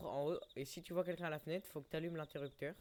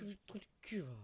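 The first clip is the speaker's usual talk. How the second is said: whispering; 5 semitones higher than usual. Pretend. Whispered repeat of the insult.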